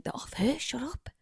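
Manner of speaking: hushed whisper through teeth. Retold or imitated. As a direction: hiss it quietly to shut him up. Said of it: "I was like".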